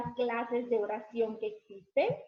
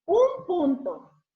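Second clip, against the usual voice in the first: "un punto" slow, higher and louder; emphatic; 6 semitones higher, 8 dB louder, much slower than usual. Shown as a demonstration. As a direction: punch "un punto" slowly. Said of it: "se escribe".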